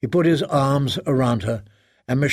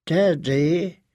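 put-on drunken slur, pitched higher; slurred; 4 semitones higher than usual. A narrator's reading. Daughter arriving drunk at her father's door, slurred. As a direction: slur the word, voice lifting at the end. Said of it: "in a slurred voice".

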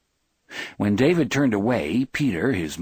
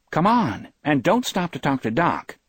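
higher-pitched, urgent nudge to move along; impatient urging; 8 semitones higher, 3 dB louder than usual. A narrator's reading. Wife tugging husband's arm to leave, impatient urging. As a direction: hurry him along in a raised pitch.

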